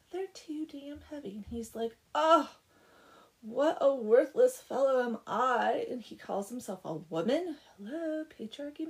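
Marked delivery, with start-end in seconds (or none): 2.1-5.9 loud, drawn-out wail of self-pity; worked up. Retold or imitated. Blacksmith failing to lift the sacks, mock anguished wail. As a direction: lament it big and slow, hammed-up. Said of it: "he gets himself all worked up".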